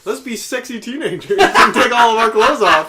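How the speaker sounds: mock-airheaded and loud; mock dumb teenager. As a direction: play a horror-movie bimbo for laughs. Mocking dumb horror teenagers.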